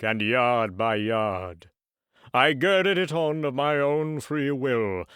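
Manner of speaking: slow, heavy, doom-laden voice; mournful ghost. Acted. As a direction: lament it like a chained spirit. Ghost describes his chain.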